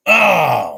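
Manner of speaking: a loud, pained "oh" as the trade dies; despairing loud groan; loud anguished groan. Real, not acted. Trade falls apart, dreams gone.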